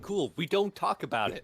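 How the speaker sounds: loud, high mock rebuke; mock scolding. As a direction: scold the friend playfully. Fight Club joke rebuke.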